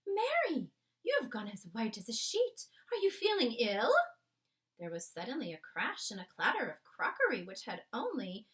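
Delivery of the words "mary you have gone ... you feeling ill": a queen upset after a bad night. The Queen tells her maid Mary about the dream, regal and shaken. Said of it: "the famous voice".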